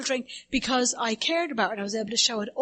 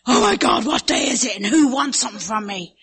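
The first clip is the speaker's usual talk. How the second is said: mock panic on waking; 6 dB louder than usual. Showing the audience how she wakes up anxious, mock panic. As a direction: jolt awake in a flap. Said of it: "that's just how I wake up".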